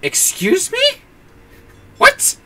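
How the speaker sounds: high, slow disbelieving question; incredulous. Acted. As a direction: act out baffled disbelief. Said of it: "I'm sitting there going".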